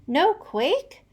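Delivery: high, loud duck query; quacked. A narrator's reading. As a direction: quack it in surprise. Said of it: "quacked Chucky Ducky".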